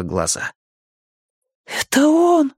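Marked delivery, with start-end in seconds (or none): 1.7-2.5 high astonished gasp of words; amazed. Acted. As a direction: say it in wonder. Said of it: "изумлённо проговорила она".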